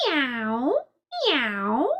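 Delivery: drawn-out meow, dipping then sweeping up; cat's meow. A narrator's reading. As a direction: meow like a cat with a rising tail. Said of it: "cried the cat".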